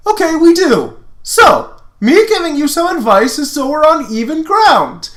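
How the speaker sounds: high, loud eager explaining; Lance voice. Acted. Lance explaining the rivalry to Lotor, high and loud. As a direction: keep it high and pushy like Lance.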